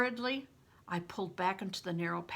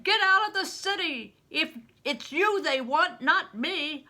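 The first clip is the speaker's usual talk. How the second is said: loud, high desperate yell; urgent shout; 8 semitones higher, 8 dB louder than usual. Acted. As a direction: roar at him to flee the city. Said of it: "Bear cried".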